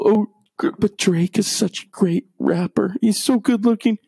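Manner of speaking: imitation of adoring fans; mock gushing fan.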